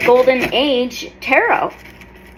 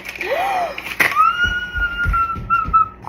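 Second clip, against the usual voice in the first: excited gasp; 5 dB quieter than usual. Real, not acted. Sees footage, gasps loudly.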